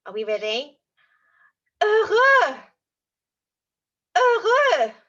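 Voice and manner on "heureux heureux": higher and louder, beaming; big happy voice. Shown as a demonstration. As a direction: sound overjoyed. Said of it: "really big happy face and say heureux".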